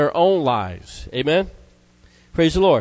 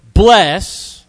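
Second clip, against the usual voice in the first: "Bless" loud and drawn out, standing alone; "Bless" punched louder; emphatic repeat; 6 dB louder than usual. Shown as a demonstration. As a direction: hit "Bless" hard and let it hang. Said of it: "he's emphasizing the word bless".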